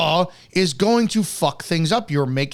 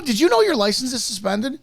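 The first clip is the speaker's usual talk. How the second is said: mock question, pitched high with a rising know; imitating a tone-deaf officer, higher; mocking cop; 9 semitones higher than usual. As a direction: ape the cop's sing-song did you know. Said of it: "Inflection in your voice".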